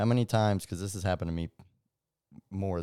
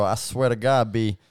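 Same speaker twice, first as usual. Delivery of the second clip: put-on New York accent; 2 semitones higher, 6 dB louder than usual. Friend showing she could pass as a New Yorker, New York accent. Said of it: "That's New York".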